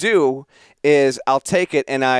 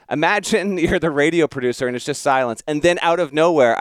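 usual speaking voice

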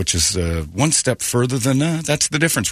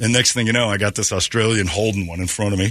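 usual speaking voice

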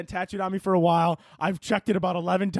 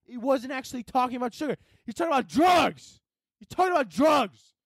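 mock shocked realization; 9 semitones higher than usual. Second realization, exaggerated.

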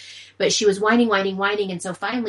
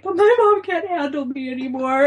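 put-on whiny child; 7 semitones higher, 5 dB louder than usual. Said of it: "And Macy's like".

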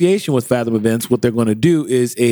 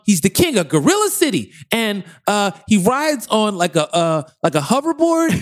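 put-on high, rambling kid pitching an idea; little kid voice; 8 semitones higher than usual. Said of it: "Are they little kids?".

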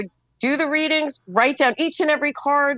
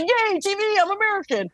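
higher, playful mock celebration; mock cheer; 9 semitones higher than usual. She jokingly celebrates getting her TV back, playful mock cheer. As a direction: cheer lightly, tongue in cheek.

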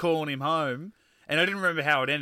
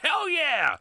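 much higher gleeful whoop; excited whoop; 14 semitones higher, 4 dB louder than usual. Retold or imitated. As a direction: cheer it out like O'Neill. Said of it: "O'Neill's just like".

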